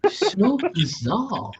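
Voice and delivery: put-on high, slow Valley Girl drawl. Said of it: "Valley Girl insults".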